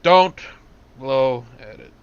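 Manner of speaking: loud, firm, word-by-word warning; emphatic warning. Real, not acted. Game master warns player off the whistle, loud and emphatic. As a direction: punch it out loudly, pausing after the repeated don't.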